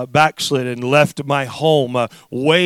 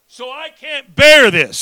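raised, high cry of protest; screamed plea; 12 semitones higher, 8 dB louder than usual. Retold or imitated. Father pleading with God over daughter, raised cry. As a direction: re-enact crying out that it is too much. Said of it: "I'm just screaming".

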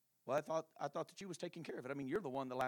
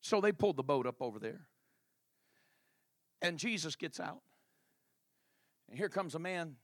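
ordinary talk

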